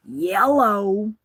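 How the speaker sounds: prank-call yellow, loud and sing-song; silly yellow greeting. Retold or imitated. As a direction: goof on the debt collector with a wacky yellow. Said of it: "practicing, like, my vocalizations".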